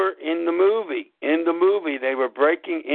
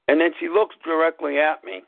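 ordinary talk

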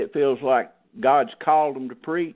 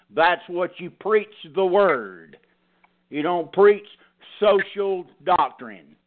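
higher, emphatic pulpit preaching; 6 semitones higher than usual. Acted. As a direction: drive the point home to the flock. Pastor preaching at congregation.

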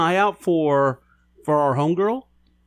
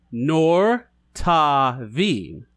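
even slower; much slower than usual. Shown as a demonstration. Repeating the phrase for a friend, very slowly. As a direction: draw "Nor Taveen" out even slower, patient teacher. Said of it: "I say it slowly".

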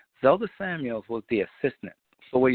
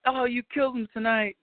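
lightly voiced manager, much higher and cheerful; chummy praise in a higher put-on voice; pleased manager; 13 semitones higher than usual. Manager praising singer backstage.

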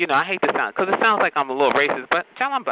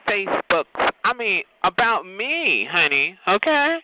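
usual speaking voice

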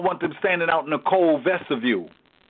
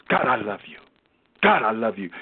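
mock churchy piety; 7 semitones lower, faster than usual. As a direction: ham up a fake holy tone for show. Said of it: "it sounds churchy".

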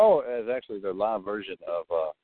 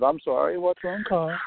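sensitive woman; 8 semitones higher than usual. Retold or imitated. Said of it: "Janine was all sensitive".

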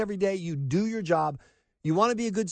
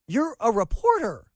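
normal talking voice

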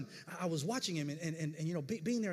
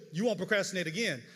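ordinary delivery

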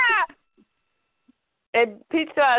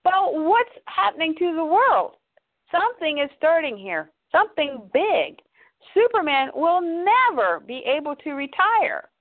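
urgent Pete; 7 semitones higher than usual. Acted. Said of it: "He goes".

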